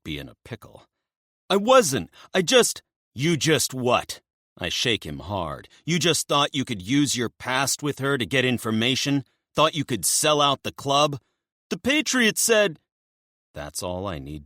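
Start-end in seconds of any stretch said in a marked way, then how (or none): 1.5-2.8 desperate denial. Acted. 3.1-11.2 angry interrogation. Acted. Narrator shaking him hard. 11.7-12.8 frightened blurt. Acted. Locken blurting in fear.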